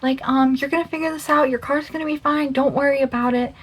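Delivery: high, gentle comforting voice; calm him down like a worried child; soothing reassurance. Retold or imitated. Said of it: "tell me why I'm being like".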